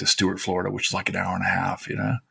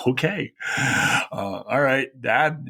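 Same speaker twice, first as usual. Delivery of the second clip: slow, unsure, higher "okay... all right"; hesitant agreement; 4 semitones higher, much slower than usual. Retold or imitated. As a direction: stretch it out, caught off guard. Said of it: "And I was like".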